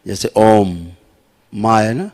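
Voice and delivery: ordinary delivery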